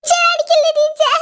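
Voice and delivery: hammed-up child wail before the cane; wailing kid. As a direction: wail at the teacher not to hit. Kid begs teacher, wailing.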